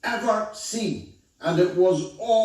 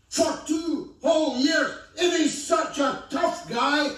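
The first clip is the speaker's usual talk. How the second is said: outraged; 7 semitones higher than usual. Real, not acted. Rising outrage over Gaza deaths.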